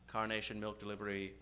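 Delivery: usual speaking voice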